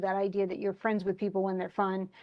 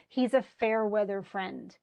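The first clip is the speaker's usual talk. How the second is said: ordinary delivery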